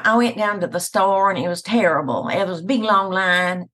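broad Southern twang; Southern accent. Shown as a demonstration. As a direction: lay on a thicker Southern accent. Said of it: "and then other times".

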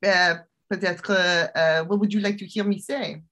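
French accent, slipping into French. Shown as a demonstration. Guest showing off languages to the hosts, in a French accent. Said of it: "give us a little French accent".

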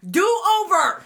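high, loud playful demand; loud teasing shout; loud do over. Real, not acted. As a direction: call out a do over.

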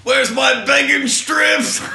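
high, slow shouted demand; mocking whiny demand. Mimicking him yelling for his bacon strips. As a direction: drag it out in a high yell. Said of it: "he says".

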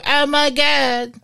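high, slow mock swoon; over-the-top, drawn-out fan squeal; hammed-up fan reaction. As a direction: stretch it out in exaggerated amazement. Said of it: "Wait, you didn't go".

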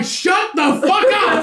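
high, drawn-out mock yell; retold angry yell. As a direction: yell it up high, stretching each word. Retold yelling at mom.

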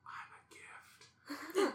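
put-on high, whispery, drawn-out child voice; child's whisper. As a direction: whisper it like a hidden kid. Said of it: "he'll go".

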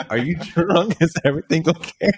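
giggling question; laughing, high. Retold or imitated. Retold reply, laughing.

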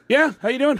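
high, eager hello; starstruck greeting. Retold or imitated. Comedian answering [name]'s introduction, starstruck. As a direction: play it overeager meeting your idol.

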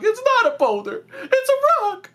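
very high, excited cartoon squeal; SpongeBob. Friend quoting SpongeBob's thrilled correction, squeaky and very high. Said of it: "And SpongeBob's like".

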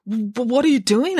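put-on startled nervous boy stumbling over "W-, what"; stammering teen boy.

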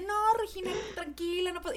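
calm the kid down, lightly voiced mom. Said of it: "Y mamá así de".

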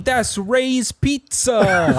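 high, slow mock New York accent; hammed-up New Yorker spotting pizza. Co-host joins the bit mocking New Yorkers, New York accent.

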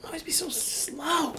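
high, slow whispered gripe; whispered grumble. Retold or imitated. Griping about slow mom in a whisper. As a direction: whisper the complaint under your breath.

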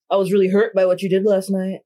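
lightly voiced dude-bro, mock sincere; a hint of a careless jock brushing it off; weird jock voice. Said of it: "I gave you, like, a whole, weird jock voice".

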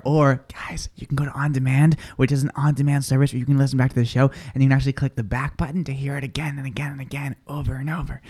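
hushed, voiceless sneaky whisper; whispered aside. Pretend. Host slipping listeners a sneaky tip, whispered. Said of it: "whispered promo aside".

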